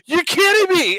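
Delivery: very high squeal of disbelief; disbelieving outcry. Retold or imitated. Retells being chased by the skunk.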